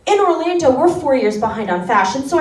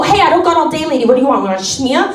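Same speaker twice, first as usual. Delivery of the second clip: impatient New Yorker; faster than usual. Retold or imitated. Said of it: "impatient New York clerk".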